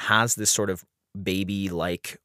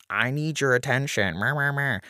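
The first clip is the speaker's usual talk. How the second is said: put-on whiny baby voice; 5 semitones higher than usual. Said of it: "and is like".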